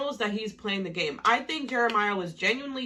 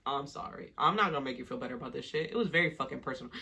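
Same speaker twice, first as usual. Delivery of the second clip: lower, quick, blunt comeback; imagined Jeremiah; 7 semitones lower, 6 dB quieter, faster than usual. Pretend. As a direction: fire it off low and fast. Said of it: "I wish Jeremiah would have been like".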